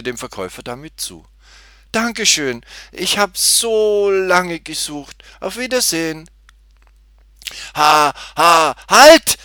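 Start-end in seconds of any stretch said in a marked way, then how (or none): 1.9-6.3 put-on high, loud girl, overjoyed; happy thanks. A narrator's reading. Tutti thanks the cashier and leaves, high and delighted. 7.7-9.4 loud, high stuttered yell; stammered shout. A narrator's reading. Cashier stops the girl leaving, stammering shout. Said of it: "rief der".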